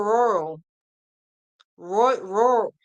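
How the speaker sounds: "rural" muddled, r's and l running together; tongue-tied attempt. Real, not acted. Crafter failing to say how country the area is, tongue-tied. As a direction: stumble on "rural", false start as "Roy-". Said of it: "I can't say it".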